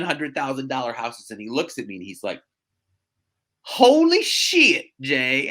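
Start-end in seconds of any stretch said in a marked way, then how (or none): 3.6-5.5 loud, high, drawn-out Southern exclamation; Southern twang. Retold or imitated. Client seeing the houses, stunned Southern twang. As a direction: imitate a stunned Southerner, stretching the curse slowly. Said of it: "shit was literally like Shiat".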